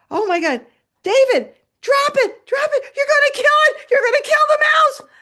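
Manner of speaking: very high, loud frantic scolding; panicked yelling. Retold or imitated. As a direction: yell urgently at the cat to let go. Yelling at cat to drop mouse.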